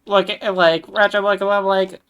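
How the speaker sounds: excited interjection. Real, not acted. Player bursts in to explain.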